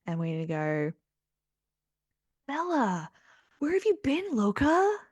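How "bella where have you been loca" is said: high, loud cheesy movie-quote voice; hammed-up teen-film hero; Jacob. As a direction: call out high, playing up the cringey line. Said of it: "when Jacob is running up to Bella".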